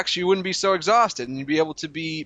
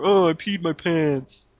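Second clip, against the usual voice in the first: slow whiny mock; mocking whine; slower than usual. Co-host mocks the host's whiny stories, slowly. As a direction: drag it out like a baby. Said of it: "You're always telling stories of".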